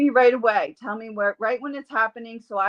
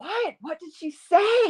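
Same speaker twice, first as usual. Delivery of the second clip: high-pitched mock amazement; astonished question; 8 semitones higher than usual. Dog's owner to healer who heard her dog, astonished. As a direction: ask it high, eager to know. Mother's amazed reaction, retold.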